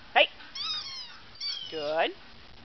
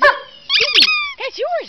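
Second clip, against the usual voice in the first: much higher, excited calling of the dog's name; 4 dB louder than usual. Real, not acted. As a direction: call the dog over in a high voice. Calling dog to her toy.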